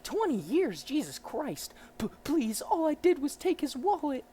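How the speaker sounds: put-on very high, pleading kid voice; hammed-up frightened squeal; panicked young thief. Arrested kid pleads in story.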